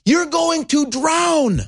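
slow, high, drawn-out cry; exasperated warning. Real, not acted. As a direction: stretch every word in high-pitched exasperation. Exasperated warning before calming himself down.

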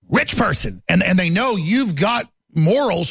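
normal talking voice

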